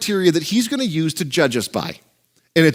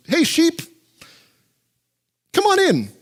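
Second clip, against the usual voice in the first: cheerful welcome; 10 semitones higher than usual. Acted. Jesus voiced welcoming sheep.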